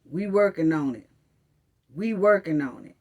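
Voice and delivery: lightly mocked bureaucrat voice; mocking officials. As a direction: mimic a bored official brushing them off. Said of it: "all you keep hearing is".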